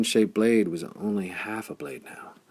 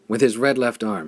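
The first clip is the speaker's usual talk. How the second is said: ordinary delivery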